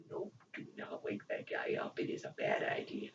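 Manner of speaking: low secretive caution; hushed warning. Pretend. Fan warning Usagi not to wake him, whispered. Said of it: "warning voiced in whisper".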